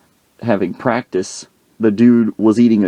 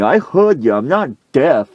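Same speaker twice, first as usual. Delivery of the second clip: high-pitched mock indignation; indignant retort; 5 semitones higher than usual. Coach answering his player, acted indignant. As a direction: snap back higher, as the offended coach.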